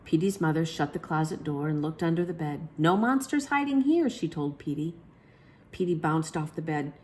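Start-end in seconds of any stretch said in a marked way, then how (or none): 2.8-4.1 reassuring. Acted. Mother reassuring child.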